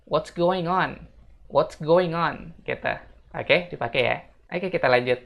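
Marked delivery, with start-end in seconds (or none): 0.0-2.6 "what's going on" high and very slow, linked; slow repeat-after-me "what's going on"; linked model phrase. Shown as a demonstration. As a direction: stretch "what's going on" for learners. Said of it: "fullnya bakal kayak gini".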